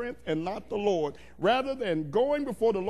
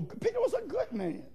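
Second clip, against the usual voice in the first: much higher, lighter-weight aside; high-pitched aside; 11 semitones higher, 4 dB quieter than usual. Real, not acted. Preacher defends Peter to the church, high-pitched aside.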